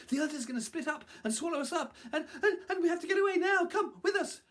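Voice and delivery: very high, fast, urgent warning; breakneck panic. A narrator's reading. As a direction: gabble it out in mounting alarm. Fleeing animals warn the deer.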